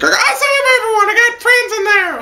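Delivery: high, fast squeaky panicked hero voice; mock Sion. Reviewer mimicking Sion's panic, squeaky and fast. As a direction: play the frantic hero, high and quick. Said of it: "Sion's like".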